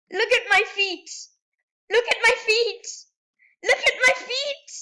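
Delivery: rising thrill with every repeat; building excitement. Real, not acted.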